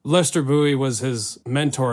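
ordinary talk, nothing marked

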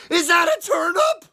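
high, loud rising shout; excited cow. Acted. Storyteller voicing Cow spotting the turnip, excited shout. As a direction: shriek the question in delight.

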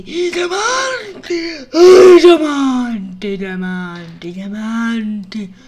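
slow, drawn-out wail for treasure; hammed-up despair, sinking from loud to low; mock wailing. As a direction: moan it out melodramatically. Melodramatic wailing for diamonds.

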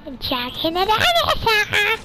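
high, slow put-on cartoon voice; squeaky Chucky voice. Kid trolling friends as Chucky in a high squeaky put-on voice. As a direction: play the goofy dinosaur Chucky.

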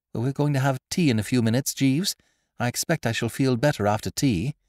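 put-on hurried young gentleman. A narrator's reading. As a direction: brace up with relief.

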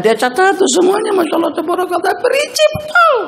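high, amazed exclamation. Acted. Preacher marvelling at the girl's detailed diary, high and amazed.

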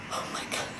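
awed whisper. Real, not acted. Overwhelmed by the taste, whispers in awe. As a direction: breathe it out with no voice.

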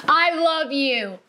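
slow, drawn-out shout of love to yourself; loud "I love you". Shown as a demonstration. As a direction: belt out "I love you" slowly. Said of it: "so loud".

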